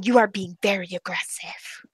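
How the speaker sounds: retold whispered accusation. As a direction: imitate someone accusing in a whisper. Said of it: "and she was still going".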